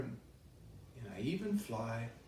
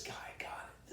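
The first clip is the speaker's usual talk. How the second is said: whispering; 11 dB quieter than usual. Acted. Suspecting someone of taking marker.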